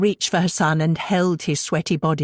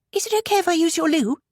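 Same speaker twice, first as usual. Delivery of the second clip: put-on high, quick girl's voice; teen girl voice; 12 semitones higher, faster than usual. A narrator's reading. Anna asking Belinda for the loo, quick and high. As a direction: blurt it out like a restless teenager.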